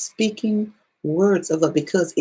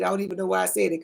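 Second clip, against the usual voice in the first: usual speaking voice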